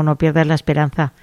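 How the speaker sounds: usual speaking voice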